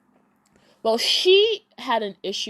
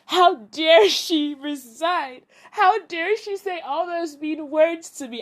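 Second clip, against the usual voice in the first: mock indignation; 8 semitones higher, 6 dB louder, slower than usual. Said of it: "He's angry".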